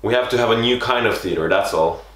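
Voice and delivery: ordinary delivery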